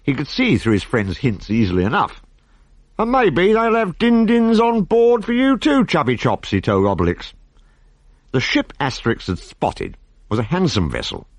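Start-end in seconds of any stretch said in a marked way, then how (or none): 3.0-6.5 high slow teasing. Acted. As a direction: tease him slowly from higher up.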